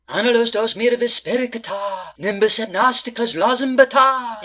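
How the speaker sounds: hammed-up chanted spell; mock incantation. Medium summoning the spirits with a mock chant. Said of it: "it kinda grows on you".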